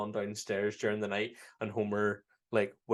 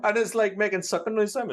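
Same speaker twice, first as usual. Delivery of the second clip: excited; 10 semitones higher, 5 dB louder than usual. Real, not acted. Laughing excited retelling.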